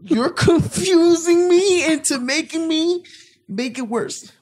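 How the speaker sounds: high, slow exasperated protest; flustered high protest. Real, not acted. Cornered in the debate, high flustered protest. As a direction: squeak out the frustration.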